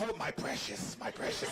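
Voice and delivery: lightly voiced Gollum, low and soft; Gollum impression. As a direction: clutch the fries like a greedy little creature. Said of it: "I hold these fries like they're the most precious thing ever".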